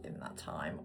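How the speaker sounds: whispering. Real, not acted. Trailing whispered aside.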